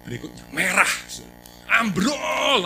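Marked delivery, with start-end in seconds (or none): 1.8-2.6 higher and louder, shouted like the sign; mock alarmed shout. Preacher reading the red warning sign as a shout. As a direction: bark the sign's warning.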